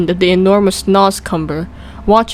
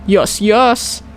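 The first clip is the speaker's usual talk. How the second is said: usual speaking voice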